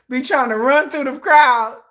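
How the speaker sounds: usual speaking voice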